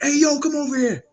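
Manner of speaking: high, loud mock holler; retold distant yell. Teammate summoned from across locker room, imitated yell. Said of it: "yelling from a distance".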